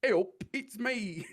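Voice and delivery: high, loud, slow Yorkshire greeting; drawn-out mock Emmerdale Yorkshire; Emmerdale Yorkshire accent. Asked to do any accent, hammed-up Emmerdale Yorkshire accent. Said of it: "everyone from Emmerdale just sounds like that".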